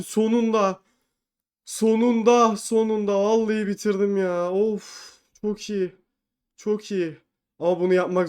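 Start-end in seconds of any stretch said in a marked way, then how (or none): none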